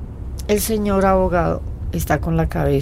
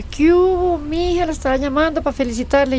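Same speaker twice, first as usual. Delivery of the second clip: her dad's greeting, pitched much higher; lightly voiced dad, much higher pitch; imitating her dad; 9 semitones higher than usual. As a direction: play the chipper dad answering the phone, higher. Dad's cheerful call, retold.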